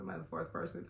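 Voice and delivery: normal talking voice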